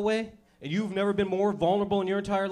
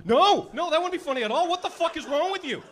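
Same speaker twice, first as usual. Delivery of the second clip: 1 alarmed buddy; 9 semitones higher than usual. Pretend. Buddy freaked out.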